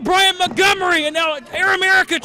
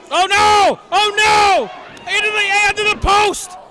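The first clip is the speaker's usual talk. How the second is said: alarmed shout; 3 semitones higher than usual. Real, not acted. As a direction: yell it out in shock as the attack lands. Attack on wrestler called live.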